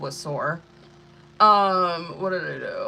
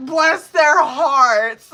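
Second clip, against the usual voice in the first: mock pity; 8 semitones higher, 11 dB louder, much slower than usual. Real, not acted. Mock pity about locals.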